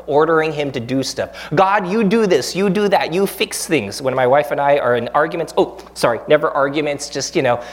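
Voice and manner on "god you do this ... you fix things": bossy prayer. Retold or imitated. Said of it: "bossing God around".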